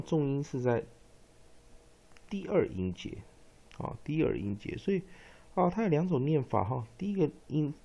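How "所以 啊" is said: ordinary talk, nothing marked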